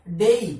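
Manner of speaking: डेई with a clear e-i glide, said loud; correct version. Shown as a demonstration. Teacher stresses the diphthong to the class. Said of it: "क्या होता है?".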